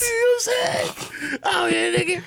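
slow, very high playful line; high drawn-out. Pretend. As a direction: stretch it out way up high.

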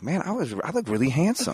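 high, light self-admiring voice, well up in pitch; pleased surprise. Retold or imitated. Actor mimics his younger self, high and self-admiring. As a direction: pitch it up like you just caught your own reflection. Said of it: "I was like".

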